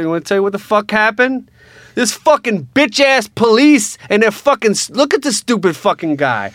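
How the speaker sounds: high, loud furious yelling; furious rant. Retold or imitated. Son to his mother about the cop, a furious yelling rant. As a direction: lose it at the cop.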